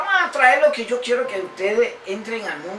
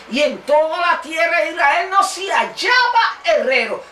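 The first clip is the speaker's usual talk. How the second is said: raised, emphatic reading of the verse; preaching scripture reading; 4 semitones higher, 4 dB louder than usual. Acted. Preacher reads the Bible verse aloud to his congregation, emphatic. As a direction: proclaim the passage to the church.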